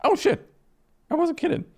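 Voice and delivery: high, sudden alarm; yelped shock at the armed crowd; startled cry. Real, not acted. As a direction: jump at the surprise. Sees room of armed sisters.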